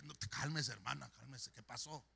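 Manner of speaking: low, soft reassurance; calming. Retold or imitated. Pastor soothing a flustered church sister, low and calm. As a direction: settle the person down quietly.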